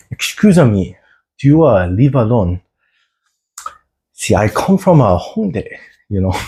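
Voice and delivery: mock street flirting, casual and cheeky; a hint of the viral Hongdae guy chatting someone up; Hongdae guy impression. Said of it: "too much Hongdae guy voice".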